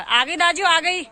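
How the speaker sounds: loud, high call across the distance. Real, not acted. Calling out to daju from outside, loud and high. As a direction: shout it out so daju hears from afar.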